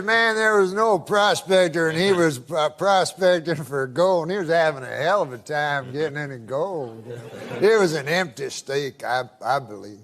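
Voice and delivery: put-on low, drawling Southern old-timer voice telling a joke; Southern accent. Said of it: "I can tell by the accent".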